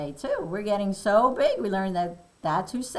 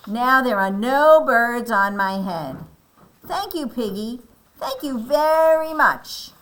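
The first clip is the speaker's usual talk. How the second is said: happy thanks; 4 semitones higher, 7 dB louder than usual. Acted. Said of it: "look how happy Elephant looks".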